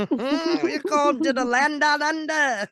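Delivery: put-on Australian accent. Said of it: "all these accents are coming in my head".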